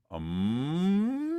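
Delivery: rising, sustained hum; demonstrated hum. Shown as a demonstration. Coach shows student how to hum, rising hum. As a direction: hum on an M, gliding upward. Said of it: "I want you to hum".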